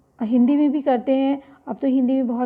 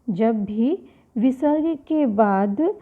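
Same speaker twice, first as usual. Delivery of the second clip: slow repeat for emphasis; much slower than usual. Shown as a demonstration. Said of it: "teacher repeats rule slowly".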